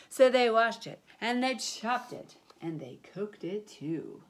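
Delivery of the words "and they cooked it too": plain reading voice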